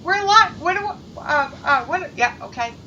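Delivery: loud, rattled stammering; flustered panic. Retold or imitated. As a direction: caught off guard on camera, scrambling. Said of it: "he's just like".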